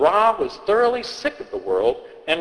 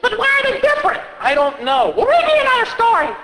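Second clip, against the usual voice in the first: put-on high, quick kid voice; a pushy little kid wanting more; demanding child; faster than usual. Child demands another story, high and quick.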